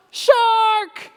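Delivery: high loud alarm shout "Shark!". A narrator's reading. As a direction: scream "Shark!" to frighten the fish. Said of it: "by shouting out".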